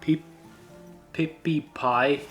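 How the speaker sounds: ordinary talk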